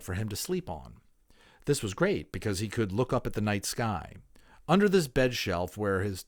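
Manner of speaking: put-on clear, lively reading of a children's book; expressive storybook narration; story narration. A narrator's reading. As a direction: read it aloud like a storyteller to listeners. Reads a children's novel aloud.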